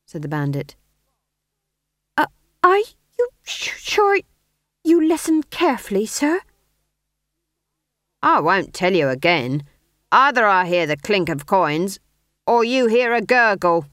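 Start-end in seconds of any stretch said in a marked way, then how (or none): 2.2-6.4 put-on nervous young apprentice. A narrator's reading. Nervous apprentice questions bandit. 8.2-13.9 loud, menacing bandit; menacing threat. A narrator's reading.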